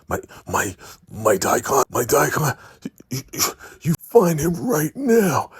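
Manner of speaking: panicked leader screaming for his man; going crazy. Retold or imitated. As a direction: lose it completely, voice shooting up. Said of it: "the highest is going crazy".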